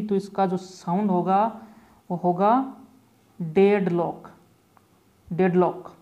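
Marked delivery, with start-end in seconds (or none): 3.4-5.9 डेडलॉक said slowly, as deh-d-lock; pronunciation demo. Shown as a demonstration. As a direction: model डेडलॉक clearly, twice. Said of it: "बात करें इसके sound की". (डेडलॉक का साफ़ उच्चारण)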